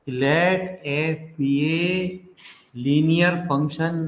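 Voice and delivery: very slow, word by word for copying; slow dictation. Shown as a demonstration. Teacher dictating to students, very slow and measured. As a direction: spell it out slowly so students can write. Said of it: "तो चलिए लिखिए प्यारे".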